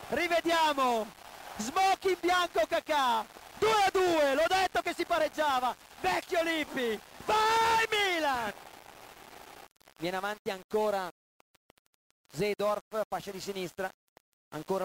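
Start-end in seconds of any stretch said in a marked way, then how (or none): 0.1-8.5 screaming goal celebration; overjoyed partisan commentator yelling; breathless italian goal commentary. Real, not acted. Kakà equaliser celebration.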